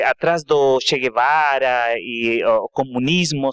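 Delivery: normal talking voice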